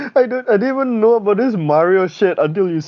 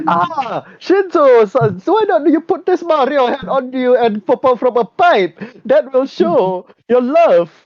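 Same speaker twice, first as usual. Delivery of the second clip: higher, slow sugary staffer voice; mock eager staffer; 7 semitones higher, slower than usual. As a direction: pitch it up like a fawning assistant. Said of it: "he goes to his team like".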